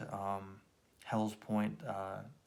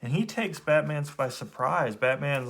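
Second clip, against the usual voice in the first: ordinary delivery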